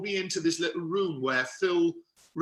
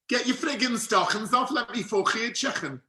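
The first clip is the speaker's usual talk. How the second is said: high-pitched Scouse with throaty k sounds; guttural Liverpudlian accent; 6 semitones higher than usual. Shown as a demonstration. Actor reciting his rude practice sentence, guttural Liverpudlian. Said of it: "all the sounds of the Liverpudlian guttural sounds".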